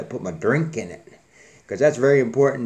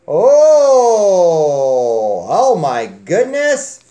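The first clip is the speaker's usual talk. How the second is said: mock surprise; 4 semitones higher, 8 dB louder, much slower than usual. Real, not acted. Surprised at a grubby hand.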